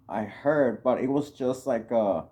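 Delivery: ordinary delivery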